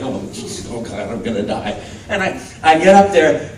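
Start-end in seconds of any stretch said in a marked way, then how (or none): 0.0-1.8 low, hushed mock dread; terrified self walking up. Nervous actor walking up for his monologue, quietly dreading it. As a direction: mutter it under your breath, doomed. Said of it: "my impression of myself walking up is like".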